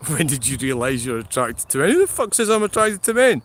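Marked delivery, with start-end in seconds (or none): 1.9-3.4 higher, louder indignant retort; indignant outburst. Real, not acted. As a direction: fire back, affronted. Indignant reply to a viewer question.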